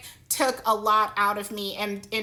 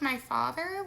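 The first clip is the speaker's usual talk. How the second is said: softer, puzzled echo; confused question; 7 dB quieter than usual. Retold or imitated. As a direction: ask it quietly, baffled. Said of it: "I'm like".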